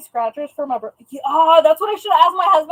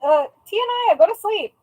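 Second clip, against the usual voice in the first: higher scolding call; higher, louder mock scolding; 7 semitones higher, 3 dB louder than usual. Real, not acted. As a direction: shoo the cat off to bed, playfully. Telling the cat off.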